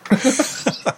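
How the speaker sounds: high, lightly voiced phone operator; collect call operator. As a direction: prison payphone operator voice.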